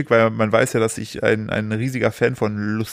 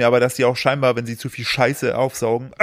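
ordinary talk, nothing marked

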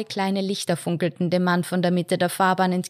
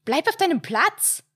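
higher ringing mother's call; sharp call; 10 semitones higher than usual. A narrator's reading. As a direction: call out sharply across the car. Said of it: "schallte die Stimme der Mutter".

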